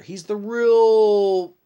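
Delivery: real stretched out long and loud; drawn-out emphasis. Acted. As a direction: hold the word real slowly for drama. Hyping the Red Ranger.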